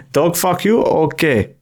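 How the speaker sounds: choppy mock Russian rule-giving. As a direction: lay down the law in a comic Russian accent. Continues the Russian lawmaker bit.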